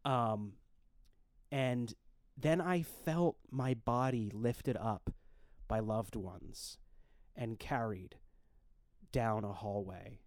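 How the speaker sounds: slow, hushed, fading storytelling; solemn dream narration. Acted. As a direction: tell it slowly, like a eulogy.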